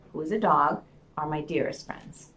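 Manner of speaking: ordinary talk, nothing marked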